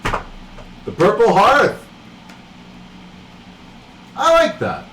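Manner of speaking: slow, drawn-out stranger's voice calling from outside; a slow, teasing voice from beyond the door; mysterious voice at the door. Acted. Stranger outside the door greets the party, slow and teasing. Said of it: "And the voice says".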